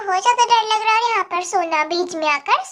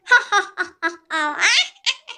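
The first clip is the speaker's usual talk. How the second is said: witch's cackle; 2 semitones lower than usual. Acted. Witch flies laughing.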